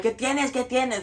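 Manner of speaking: high-pitched retold motherly challenge; friend's mom. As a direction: question sharply in Spanish as someone's mom. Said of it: "Juan's mom was like".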